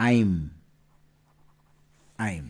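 আইম said as one clipped syllable; contraction demo. Shown as a demonstration. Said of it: "এটাকে আমরা কি করব?". (সংক্ষেপে আইম উচ্চারণ)

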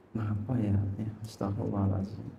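low, hushed murmur to oneself; softly acted dejection, pitched low; mock sad brooding. Someone brooding alone in their room, sad and hushed.

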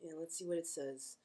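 normal talking voice

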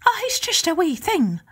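high, lilting Irish wife; Una's voice. Acted. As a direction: sweetly put off the visitor in a high voice. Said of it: "Una said".

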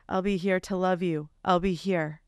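hammed-up dramatic voice, pitched low; dramatic reading. Co-host reading a love note aloud on request, dramatic. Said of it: "In your most dramatic voice".

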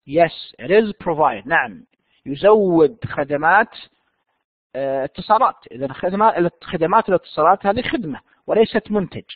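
ordinary talk

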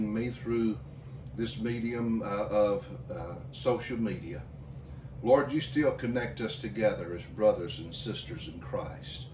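low, soft pastoral prayer. Real, not acted. Pastor praying over communion with the congregation, low and gentle. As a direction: pray quietly and unhurriedly.

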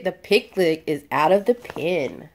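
usual speaking voice

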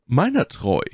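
exclaimed. A narrator's reading. Aramis exclaims to his friends, exclaimed. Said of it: "rief Aramis".

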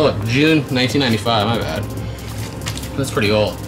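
usual speaking voice

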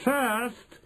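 high, held-out opening word; Grand High Witch, commanding. Acted. Grand High Witch starting her lesson, commanding. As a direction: pitch it high to take the floor.

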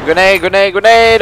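urgent shouted warning; grenade warning yell. Real, not acted. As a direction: yell it out to warn the team.

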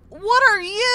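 high loud startled yelp; startled shout. Real, not acted. Gamer startled by a strange creature, loud yelp. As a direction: jump at the sight of it.

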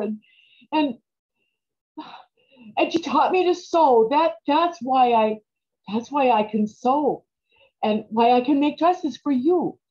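usual speaking voice

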